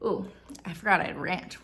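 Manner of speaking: usual speaking voice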